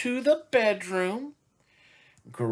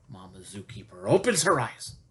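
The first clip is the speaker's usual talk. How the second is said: usual speaking voice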